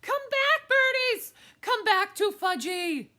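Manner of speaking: put-on very high, very loud little-kid scream; high full-volume child's yell; toddler screaming. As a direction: scream after the birds like a toddler. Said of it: "was screaming".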